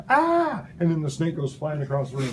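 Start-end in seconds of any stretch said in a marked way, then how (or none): 0.1-0.6 high, startled yelp of pain; mock yelp. Teacher acts out a bitten student, high yelp. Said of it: "and you go".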